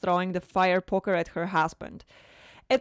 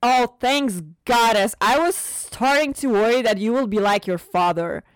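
relieved; 4 semitones higher, 7 dB louder than usual. Acted. Mother relieved about her daughter.

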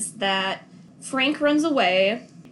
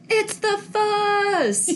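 high, drawn-out comic call; hammed-up film quote, laughing; mock alarm cry; 9 semitones higher, much slower than usual. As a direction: stretch it out high like a silly warning. Quoting a joke from film.

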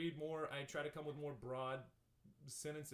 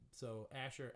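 ordinary talk

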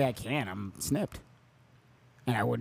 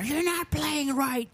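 put-on high, slow bratty kid yell; little girl yelling; 13 semitones higher, slower than usual. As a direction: shriek it like an angry little girl.

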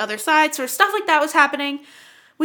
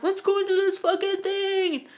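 put-on higher hyped-up kid; daring kid voice; mock bravado; 7 semitones higher than usual. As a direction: pump yourselves up. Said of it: "We're like".

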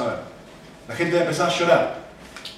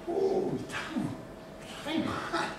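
high, slow breathy whisper of dismay; 11 semitones higher, 12 dB quieter, much slower than usual. Retold or imitated. People caught by the Word, whispering in dismay. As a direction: whisper it as if ashamed. People realizing their sin.

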